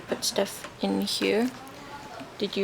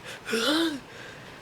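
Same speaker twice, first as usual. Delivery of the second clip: re-enacted waking-from-nightmare gasp; sharp, higher mock gasp of shock; acted gasp; 6 semitones higher than usual. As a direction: gasp as if jolting awake. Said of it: "I was like".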